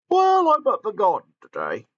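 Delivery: high, bright-eyed character voice; Gordon's voice. Acted. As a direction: raise the pitch for Gordon. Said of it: "said Gordon".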